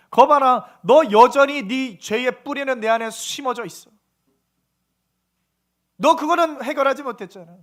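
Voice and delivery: loud, emphatic told-you-so; God rebuking. Acted. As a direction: voice God exposing the hidden sin. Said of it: "그걸 말씀하시는 거예요". (꾸짖는 하나님처럼 크고 힘주어)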